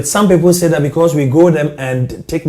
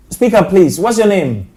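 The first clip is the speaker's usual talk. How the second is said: raised voice over a bad line; to someone hard of hearing; 6 semitones higher, 3 dB louder than usual. Real, not acted. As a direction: call out clearly to a faint caller. Caller barely audible on phone.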